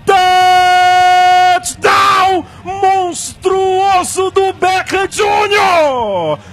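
loud, slow, stretched touchdown scream; touchdown call. Real, not acted. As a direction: bellow it to the whole stadium. Narrator calls a spectacular catch.

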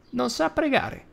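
put-on high, grumbling old woman; high granny grumble; grumbled. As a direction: mutter it like a cross granny. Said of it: "borbottò".

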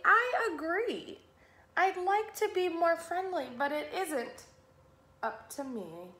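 high plea falling away at the end; helpless glum fish. Acted. Pout-pout fish excusing himself to Mister Jelly, helpless.

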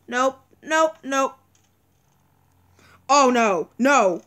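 ordinary talk

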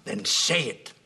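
loud challenge to his son; angry demand. Acted. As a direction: force him to speak up. Father confronting son.

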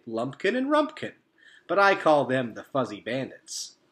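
loud, hearty beaver introductions; jolly beaver. A narrator's reading. Mister Beaver introducing the raccoons, loud and jolly. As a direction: welcome the raccoons like a proud host.

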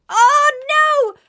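high, loud panicked cry; alarmed shout. Real, not acted. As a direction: yelp as danger appears. Monster appears.

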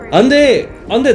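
excited shout. Real, not acted. Streamer reacting to heroine.

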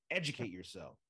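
low, falling, stern sign-off; angry-sounding. Real, not acted. Host telling listeners unfamiliar with Ed Whalen, mildly angry. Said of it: "that sounded angry".

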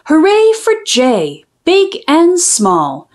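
ordinary delivery

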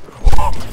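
loud, sharp gasp; startled gasp. Real, not acted. Player suddenly attacked, startled gasp. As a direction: gasp loudly in alarm.

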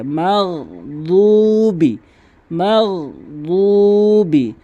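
slow chanted মাগদুবি with throat gh; ghayn and stretch. Shown as a demonstration. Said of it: "এক আলিফ টানবেন". (মাগদুবি-তে গয়ন, এক আলিফ টান)

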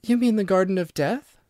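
understated whisper, still voiced; hushed question. A narrator's reading. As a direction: ask it in timid wonder. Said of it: "she whispered".

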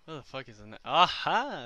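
ordinary talk, nothing marked

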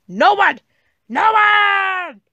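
high, loud yell building to a long holler; emphatic shouted denial; angry shout. Acted. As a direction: bellow it twice, the second stretched out. Angrily denies that anyone is there.

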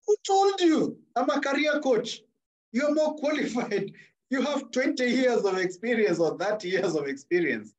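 high-pitched incredulous protest, easing down; retold disbelief. Coach protesting to professors who feel stuck, high-pitched disbelief.

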